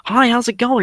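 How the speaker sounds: high-pitched Texan impression; Owen Wilson. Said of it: "He's got that Texan accent".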